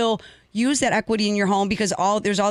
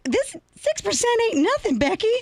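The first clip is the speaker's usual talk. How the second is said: much higher, mock old Southern lady accent; Southern grandma accent. Grandma scoffing at Becky over rates, Southern accent. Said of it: "with a little Southern accent".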